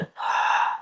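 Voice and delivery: slow audible exhale through the mouth; long breath out. Shown as a demonstration. Guiding listeners through a breath, long sighing exhale. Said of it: "And now take a longer breath out".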